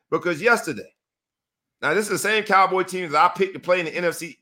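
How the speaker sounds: normal talking voice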